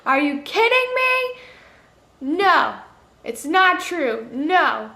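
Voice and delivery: high, loud cry of denial; disbelieving outburst. Retold or imitated. Reader refusing the twist, a loud high outburst. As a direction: protest loudly against the twist. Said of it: "I was like".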